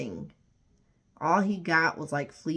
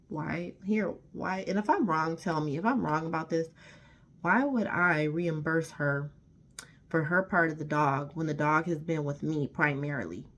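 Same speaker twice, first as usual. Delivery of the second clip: normal talking voice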